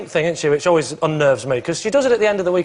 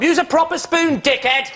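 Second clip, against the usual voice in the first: higher-pitched mock rebuke; abrasive retort; 10 semitones higher, slower than usual. Ice cream lid scolding whoever opens it, abrasive. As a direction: snap out a rude instruction, pitched up.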